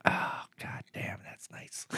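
hushed, savoring whisper. Acted. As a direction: breathe it out in pleasure. Savoring licking the jacket.

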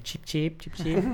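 quiet high chick peeps; baby chick peeps. Pretend. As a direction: peep like a little chick. Teasing friend as chicken.

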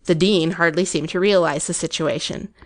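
ordinary delivery, nothing marked

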